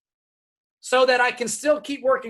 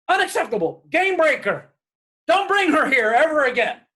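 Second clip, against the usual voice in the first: higher, louder outraged verdict; indignant mother; 6 semitones higher, 5 dB louder than usual. Retold or imitated. Mother forbidding her son's girlfriend, higher and louder. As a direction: slam the door on it.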